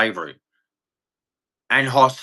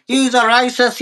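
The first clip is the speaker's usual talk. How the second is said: mock accusation; 8 semitones higher than usual. Ranting vlogger parodies a protesting woman, high mocking voice. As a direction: parody her shrill charge as a put-down.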